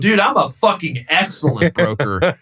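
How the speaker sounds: high-pitched comeback, breaking into laughter; retold indignant boast. Broker defending himself to angry tenant, indignant. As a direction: retell the boast with amused indignation.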